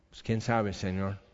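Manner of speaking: low, soft, unsure answer; hushed uncertainty. Acted. Ezekiel answering God about the dry bones, hushed and unsure. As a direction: answer softly, dropping away on the last word.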